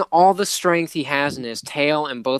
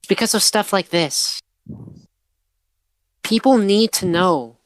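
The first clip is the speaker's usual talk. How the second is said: higher, impassioned reporter voice; earnest reporter; 6 semitones higher than usual. Acted. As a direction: plead for why she does this job. Plays reporter Patterson earnestly.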